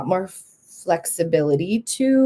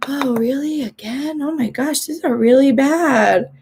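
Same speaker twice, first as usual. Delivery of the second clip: imitated dismayed concern; 6 semitones higher than usual. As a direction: act out a friend's repeated surprise, voice lifted. Acting friends reacting to repeated migraines.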